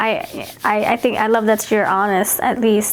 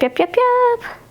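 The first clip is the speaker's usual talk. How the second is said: mock squeaky yep; 13 semitones higher, slower than usual. Reader mocks clingy agreement.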